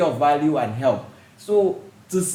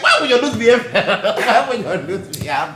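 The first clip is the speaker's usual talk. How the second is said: laughing disbelief; amused incredulous question; 6 semitones higher, 3 dB louder than usual. Retold or imitated. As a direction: ask it through laughter.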